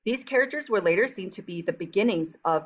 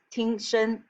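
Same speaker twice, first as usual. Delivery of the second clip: slow teacher voice; 3 semitones higher, slower than usual. Shown as a demonstration. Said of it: "we will do this during class".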